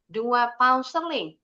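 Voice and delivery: normal talking voice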